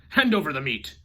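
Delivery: loud gruff demand; pirate demand. A narrator's reading. Braidbeard at dinner demands meat, loud. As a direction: bark it with a full mouth.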